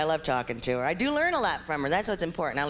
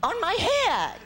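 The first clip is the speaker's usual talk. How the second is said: whiny answer; 14 semitones higher, 5 dB louder, much slower than usual. Retold or imitated. Daughter whining answer.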